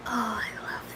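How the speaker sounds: normal talking voice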